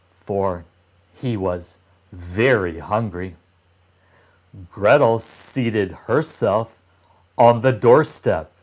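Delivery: ordinary delivery, nothing marked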